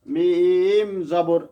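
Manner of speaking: মিম জবর said slowly, letter name stretched; slow spelling মিম জবর. Shown as a demonstration. Teacher shows how to spell মিম জবর when spelling. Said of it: "প্রথম তো এরম বলা লাগে".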